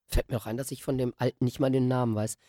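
usual speaking voice

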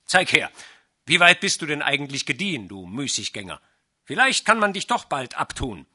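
loud, fast angry scolding; angry shout. A narrator's reading. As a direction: snap at the idler. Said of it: "rief Pinais erbost".

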